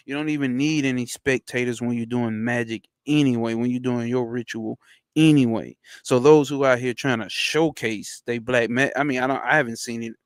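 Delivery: usual speaking voice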